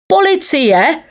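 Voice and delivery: high-pitched call for the police. A narrator's reading. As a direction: call for help in a high, rising voice. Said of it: "volal synovec pana rady". (vysoké volání o pomoc)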